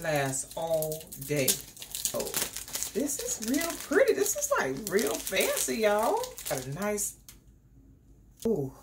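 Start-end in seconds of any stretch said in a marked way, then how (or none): 2.9-6.2 high, gushing delight; excited gush. Real, not acted. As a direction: gush over how fancy it is. Admiring fancy box.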